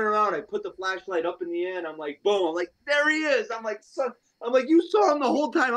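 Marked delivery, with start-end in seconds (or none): 2.9-5.8 high-pitched mock exasperation; disbelieving high complaint to a friend; exasperated outburst. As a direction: squeak it in disbelief.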